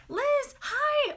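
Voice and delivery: high, loud delighted hello; light up and call out to a friend; squealed happy hi; excited greeting. Real, not acted. Greeting a viewer in chat.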